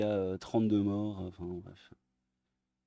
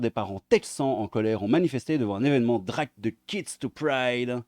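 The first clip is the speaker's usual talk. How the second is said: normal talking voice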